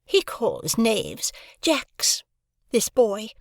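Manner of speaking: put-on high, slow, scornful girl; disdainful. A narrator's reading. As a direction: sneer it down at the boy. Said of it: "said Estella with disdain".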